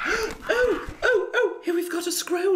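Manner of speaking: high, breathless mock excitement; excited. Party member spotting the raven's scroll, excited high voice. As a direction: gasp it out, thrilled.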